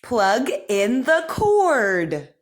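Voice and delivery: slow, careful speech-therapy reading; crisp model sentences for repeating; over enunciating. Shown as a demonstration. Therapist reads practice sentences.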